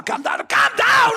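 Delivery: urgent pleading. Retold or imitated. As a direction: act out urgently reining someone in. Retold crowd calming him down.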